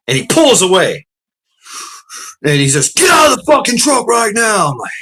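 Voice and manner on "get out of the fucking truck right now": imitating an officer shouting orders; shouted order. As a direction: yell a furious command at a driver. Retold trooper ordering him out.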